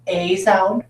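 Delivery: "a" said as its letter name, "ay"; long A sound. Shown as a demonstration. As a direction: stretch "a" into a long, slow "ay". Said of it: "an a sound".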